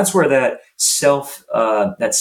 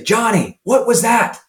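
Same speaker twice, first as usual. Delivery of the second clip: sharp, high reproach from a coach; scolding coach; 10 semitones higher than usual. Retold or imitated. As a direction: call the kid out for missing.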